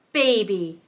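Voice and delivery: usual speaking voice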